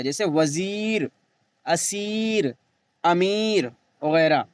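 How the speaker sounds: वज़ीर, असीर, अमीर with the ई stretched slowly; long ee. Shown as a demonstration. Teacher showing the stretched ye. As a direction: draw out the ई long. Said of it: "खूब खींच कर पढ़ी जाए".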